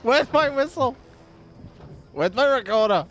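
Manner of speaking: ordinary delivery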